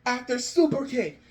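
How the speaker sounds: fast, excited ringside call of the match; live play-by-play. Acted. Fan narrating his dream match as an excited play-by-play. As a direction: call every move like a commentator at ringside. Fan calls imagined SummerSlam finish.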